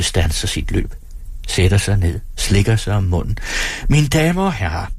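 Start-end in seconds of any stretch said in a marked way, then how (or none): 3.9-4.9 mock-solemn stage address, pitched up; lightly grave announcer; serious declaration. A narrator's reading. Performer addresses the audience, mock serious. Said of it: "erklærer han med alvorlig stemme".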